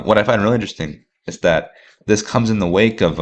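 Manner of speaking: ordinary delivery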